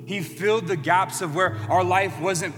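rising preacher's appeal, pleading and full of conviction; impassioned preaching. Acted. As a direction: build to the altar-call peak, voice lifted. Music-backed altar appeal.

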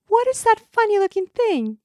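put-on high, loud curious young princess; cartoon princess. A narrator's reading. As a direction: squeak out a wide-eyed question. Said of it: "she asked".

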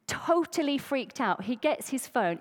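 ordinary delivery

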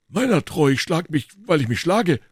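high embarrassed answer; flustered musketeer; blushing. Acted. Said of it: "errötend".

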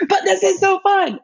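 high, excited squeal of enthusiasm; re-enacted gleeful cheer. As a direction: burst out high, thrilled. Her excited reply to tired riders.

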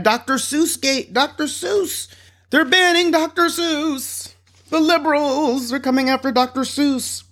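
high-pitched mock alarm; hammed-up panic, stretched out; mock outrage. Host mocking the scandal over Doctor Seuss, mock outrage.

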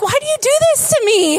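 high, quick mock whine; mock exasperation. Pastor replays her own groan at husband, high and fast. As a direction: plead in playful despair.

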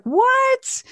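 high shocked squeak of disbelief; surprised. Retold or imitated. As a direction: jump way up in shock. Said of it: "I was like".

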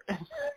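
high, breathy gasp of laughter; helpless squeaky laugh; wheezing laugh. Real, not acted. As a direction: lose it at the best part. Laughing at favorite part.

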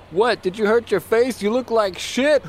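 high, jeering voice; mocking dock worker. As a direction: sneer it like a rude dock hand. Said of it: "another dock attendant said".

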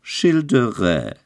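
mocking devil voice; sinister devil. A narrator's reading. Devil mocks Childeret by name, sinister. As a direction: sneer his name darkly. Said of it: "sagte der Teufel mit finsterem Gelächter".

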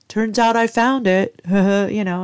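cheeky husband voice ending in a laugh; imitated laughing. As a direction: imitate him amused with himself. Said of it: "he starts laughing".

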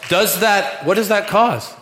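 high, loud preacher's question; loud challenge to the crowd; loud rhetorical question. Acted. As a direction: challenge the congregation.

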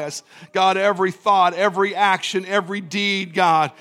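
steady murmured prayer with repeated "God"; fervent prayer. Real, not acted. Pastor leading the church in repentance prayer, earnest and steady. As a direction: pray aloud over the church, leading their repentance.

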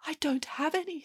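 small, soft, high voice; meek little girl. A narrator's reading. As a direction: admit it timidly, very softly. Shoeless girl answers meekly.

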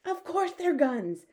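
high, loud, drawn-out insistence; slow, high-pitched outraged shout; indignant shout. A narrator's reading. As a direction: shout it slowly, affronted. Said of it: "shouted the head of the army".

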